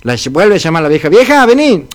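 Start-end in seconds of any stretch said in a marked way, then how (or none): 1.1-1.9 put-on raised, higher voice of an old man calling his wife. Old man in bed calls his wife to come. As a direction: call out to her from the bed.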